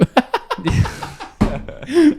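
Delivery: shrill burst of laughing; squealing laugh; high squeal of laughter. Real, not acted.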